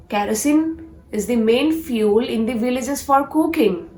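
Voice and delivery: normal talking voice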